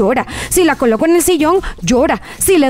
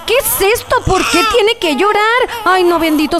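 higher, hammed-up whining question; mock exasperation; 6 semitones higher than usual. Mother complaining in mock exasperation about the baby. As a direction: act fed up with the crying.

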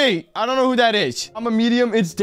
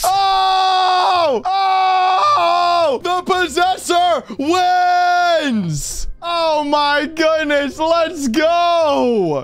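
excited shouting; 6 semitones higher, much slower than usual. Real, not acted. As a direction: yell it out in triumph. Child winning the round.

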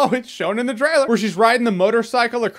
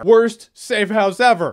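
slow, louder, every word punched; deadpan verdict; 5 dB louder, slower than usual. Real, not acted. Reviewer mocking the movie's safe house, slow and emphatic. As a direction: drop it like a final verdict.

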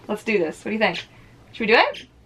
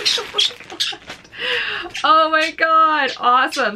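thrilled gasp; 7 semitones higher, 3 dB louder than usual. Real, not acted. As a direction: gush over the gift. Thrilled by the gift.

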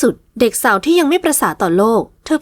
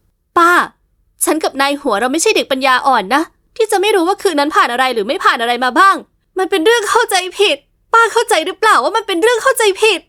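shouting; 6 semitones higher than usual. Acted. Niece shouting at her aunt that it was a misunderstanding. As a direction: shout it out so she finally listens. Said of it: "หญิงสาวตะโกนลั่น".

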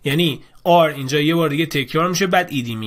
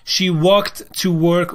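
slow dictation read; 2 semitones higher, 3 dB louder, much slower than usual. Shown as a demonstration. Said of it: "reading answers slowly".

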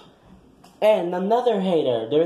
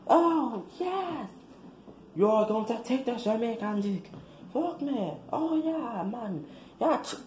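high, soft, moaning put-on voice; mock lewd moaning; 8 semitones higher, 7 dB quieter than usual. Playing the lewd video footage to the auditorium, high and moaning. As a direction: ham up a breathy porn voice.

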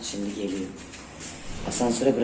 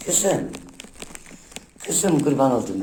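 higher, louder fond cooing; 4 semitones higher, 3 dB louder than usual. Real, not acted. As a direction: dote on the pet. Cooing at her pet. (yüksek sesle sevgi dolu seslenme)

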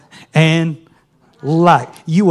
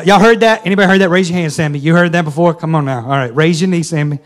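ordinary talk, nothing marked

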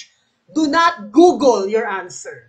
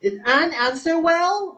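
yes-no tune, rising on "well"; rising intonation; 4 semitones higher than usual. Shown as a demonstration. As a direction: question climbing to "well". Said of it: "the correct answer is rising intonation".

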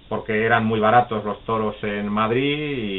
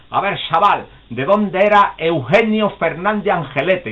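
put-on quizzing old man; 7 semitones higher, 5 dB louder than usual.

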